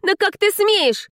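very high, slow, indignant cry; high-pitched burst of temper; furious outrage. Acted. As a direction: explode at his impudence, stretching the words. Heroine shakes him in fury.

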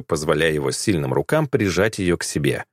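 plain reading voice

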